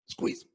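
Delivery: quick sharp gasp through the nose, then squeeze. Shown as a demonstration. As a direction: gulp in that last bit of air. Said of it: "let's try it".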